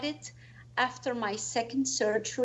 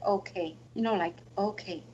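soft surrender; 4 semitones lower, 7 dB quieter than usual. Retold or imitated. Surrendering to pain after surgery.

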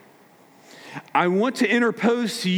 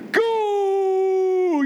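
long high shouted Goal!; high drawn-out excited yell; kid's goal cheer; 13 semitones higher than usual. Retold or imitated. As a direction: crow it like a child who just scored. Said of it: "they're the ones going".

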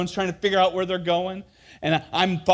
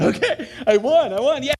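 put-on laughing kid; 8 semitones higher, 6 dB louder than usual. Kid responds flatly, laughing.

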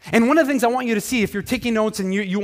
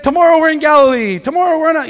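high, loud mock command; playful Jesus urging tired disciples on; hammed-up brisk leader; 9 semitones higher, 9 dB louder than usual. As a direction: call out the plan like an eager leader. Jesus voiced driving disciples on.

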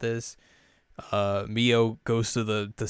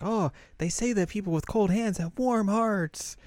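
high, lilting imitation of a girl; cutesy pitched-up comforting; sweet consoling girl; 11 semitones higher than usual. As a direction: console a friend in a sugary high voice. Yui consoles cold-handed Mio.

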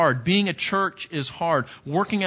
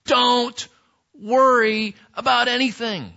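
"Don't" pushed high and louder; forceful command; 6 semitones higher, 4 dB louder than usual. Shown as a demonstration. Preacher voicing Paul's command to the church, forceful. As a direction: bark the command, hitting "Don't". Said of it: "what Paul is saying is".

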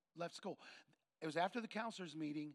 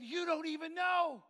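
high, slow, drawn-out shout; imitated emotional outburst; 9 semitones higher, 9 dB louder than usual. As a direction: wail it, acting overcome. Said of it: "He's sobbing".

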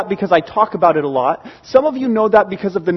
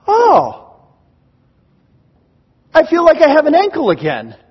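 surprised relief; 8 semitones higher, 3 dB louder than usual. Retold or imitated. Sudden relief after the adjustment.

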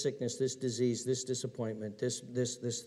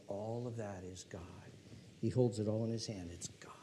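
hushed awed whisper; whispered wonder; 4 semitones lower, 5 dB quieter than usual. Acted. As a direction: whisper it in awe. Awed whisper about God.

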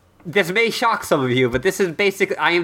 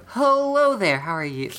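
bright, high phone greeting; sweet customer service voice; 5 semitones higher than usual. Shown as a demonstration. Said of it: "customer service voice".

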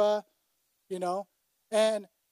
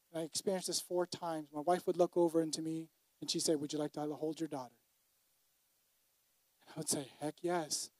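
much softer, intimate recollection; tender memory; 5 semitones lower, 9 dB quieter than usual. Real, not acted. Pastor recalling holding his newborn daughter, softly tender.

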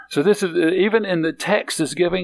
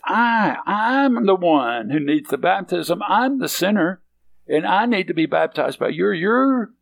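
raised voice, leaning on each "I" and "I'm"; real emphatic; 4 semitones higher than usual. Acted. John telling Jesus who needs the baptism, emphatic. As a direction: insist on "I'm the sinner", pitch lifted on "I'm". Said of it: "is real emphatic".

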